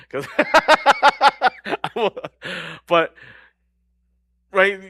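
breaking into laughter, high and loud, slow; laughing. Real, not acted. Preacher admits he's a terrible bragger, laughing. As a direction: laugh at yourself mid-sentence.

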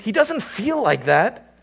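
impassioned sermon climax; preaching at full force. Acted. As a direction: cry out his abandonment.

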